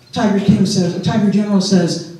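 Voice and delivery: usual speaking voice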